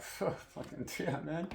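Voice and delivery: whispered under breath, mock disbelief; hushed aside. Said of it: "and you're like".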